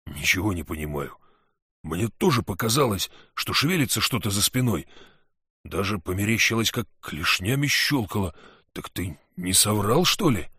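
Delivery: lightly voiced big hunter; a hint of a relieved big man; hunter's voice. Valu puzzled, asks Sid.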